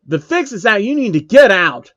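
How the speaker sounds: high and loud crowd yell; screaming. Acted. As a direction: shout him out of town. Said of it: "someone in the crowd screams".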